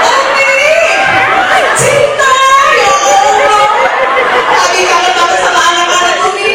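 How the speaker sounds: high, loud, hammed-up lovey-dovey gushing; overeager. A husband gushing that he misses wife and kids, overeager. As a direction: draw out the endearments, laughing and loud. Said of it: "yang langsung bereaksi mulai dari turun pintu".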